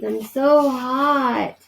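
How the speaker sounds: slow, sleepy feverish mumble; mumbled. Acted. Sick boy mumbling to his mother, slow and feverish. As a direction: drag the words out like a sick child. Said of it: "mumbled Keith".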